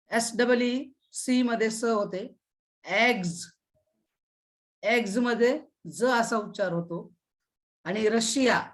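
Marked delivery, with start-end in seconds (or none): none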